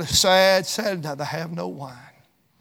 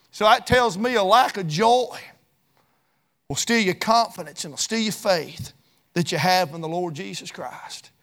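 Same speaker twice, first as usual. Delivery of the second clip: ordinary delivery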